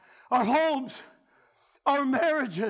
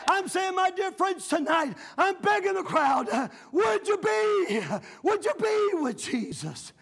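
a high, urgent plea to the crowd; altar-call appeal; pleading preaching; 4 semitones higher than usual. Acted. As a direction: beg them to answer. Begging the crowd.

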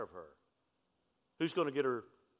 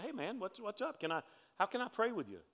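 soft, higher-pitched concerned approach; gentle consoling; 7 semitones higher, 5 dB quieter than usual. Retold or imitated. Pastor retelling his approach to a sobbing man, softly consoling. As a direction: gently imitate checking on someone upset.

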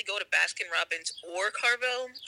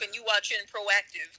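normal talking voice

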